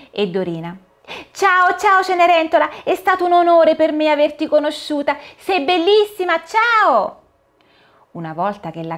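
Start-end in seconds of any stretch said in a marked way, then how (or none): 1.3-7.2 put-on high child's voice, thrilled and a bit breathless; a starstruck girl meeting her heroine, pitched high; excited little girl. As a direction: Dorina introduces herself to Cinderella, high and thrilled. Dorina bids farewell.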